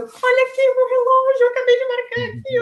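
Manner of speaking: high, loud, pleading proof; indignant insistence. Retold or imitated. To the finish official, she insists with high indignation. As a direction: shove the watch in her face.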